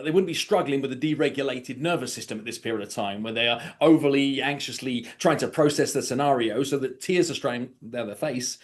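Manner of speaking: usual speaking voice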